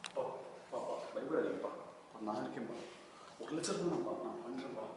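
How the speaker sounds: quiet, half-breathed comfort for his father; soft reassurance. Acted. Son comforting his worried father on the phone, soft and hushed. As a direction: hush him gently, partly whispered.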